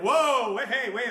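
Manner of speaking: loud, high mock alarm; hammed-up backpedalling; startled protest. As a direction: burst out as if fending off a recruiter. Acting out startled mall shopper recruited.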